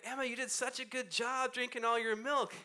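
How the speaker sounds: dad praising his daughter for her milk. Retold or imitated. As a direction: gush over the child. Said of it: "I'm like".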